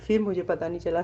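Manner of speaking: ordinary delivery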